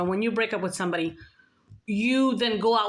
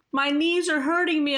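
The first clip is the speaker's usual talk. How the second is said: high, louder mimicked plaint; imitated complaint; 8 semitones higher, 5 dB louder than usual. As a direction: act out his complaint in a high voice. Voices the homeless man's complaint.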